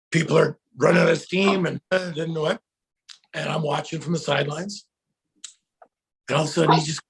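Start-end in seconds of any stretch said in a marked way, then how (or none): none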